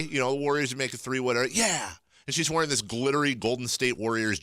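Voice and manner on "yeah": imitated cheer. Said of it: "She kept, like, after every play".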